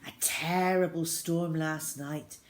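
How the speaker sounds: ordinary delivery, nothing marked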